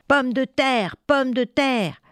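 restrained storyteller's battle cry; mock soldiers' yell. A narrator's reading. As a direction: chant it like hungry troops. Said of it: "hurlaient les soldats".

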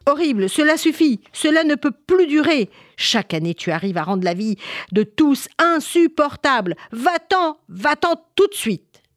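high, forceful royal outburst; king shouting. Acted. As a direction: order the monster out with a cry. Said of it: "Le roi cria".

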